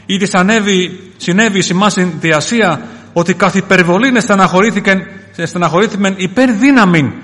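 loud, high preaching voice; declaimed scripture. Acted. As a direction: proclaim the epistle at full force. Declaims scripture at full force.

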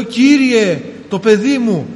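loud, high plea; a father's loud plea to the Lord; retold cry to the Lord. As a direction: cry out for the child. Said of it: "Όσο φώναζα στον Κύριο".

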